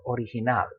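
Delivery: "original" in Spanish as o-ri-hi-NAL; stress on last syllable. Shown as a demonstration. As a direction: land on NAL at the end of "original". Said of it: "which syllable to stress".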